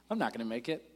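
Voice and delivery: real tired voice barely holding; voice giving out. Real, not acted. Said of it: "I'm already losing my voice".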